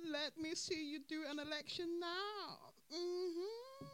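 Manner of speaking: high, slow, smug taunt; smug Karma. Retold or imitated. Karma smirking at rivals, high smug slow taunt. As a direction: gloat like a smug spectator. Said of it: "smiling to herself like".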